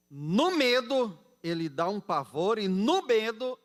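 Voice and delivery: loud, stretched key words from the pulpit; impassioned warning to the congregation; emphatic preaching. Real, not acted. Preaches at full force.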